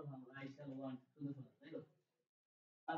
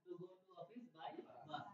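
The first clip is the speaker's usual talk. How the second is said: slow model; 6 dB quieter, much slower than usual. Shown as a demonstration. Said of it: "একসাথে বলবো".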